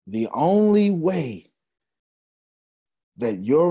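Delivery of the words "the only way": preaching emphasis. Acted.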